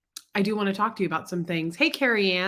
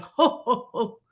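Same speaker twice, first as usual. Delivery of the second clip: jolly owl laugh; slower than usual. Retold or imitated. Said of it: "And he's like".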